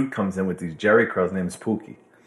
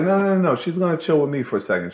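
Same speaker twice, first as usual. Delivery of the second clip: much higher "no, no, no, no"; a higher, insistent voice for Pookie; Pookie insisting; 7 semitones higher, faster than usual. Retold or imitated. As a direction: cut in to hold her back.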